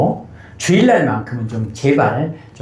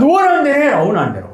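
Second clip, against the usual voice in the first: imagined defiant retort; 12 semitones higher, much faster than usual. Pretend. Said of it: "그러고 싶더라고요".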